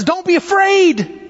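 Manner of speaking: high, urgent call across the water; shouted. Retold or imitated. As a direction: call it out high, stretching the words. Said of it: "as he shouts out".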